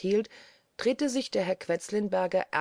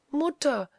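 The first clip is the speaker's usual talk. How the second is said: high startled cry of recognition; sudden high, glad gasp of a call; half startled, half joyful; 8 semitones higher, 4 dB louder than usual. A narrator's reading. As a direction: call out high, caught between fright and joy. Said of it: "halb erschreckt, halb erfreut".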